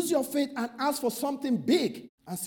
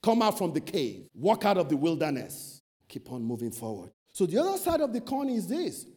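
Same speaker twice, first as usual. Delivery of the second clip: ordinary talk, nothing marked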